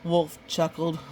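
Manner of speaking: ordinary delivery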